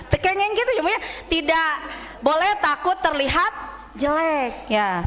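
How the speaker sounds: usual speaking voice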